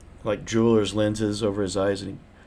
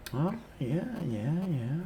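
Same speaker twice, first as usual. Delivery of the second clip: put-on old prospector; 7 semitones higher, 4 dB quieter, slower than usual. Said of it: "like an old prospector".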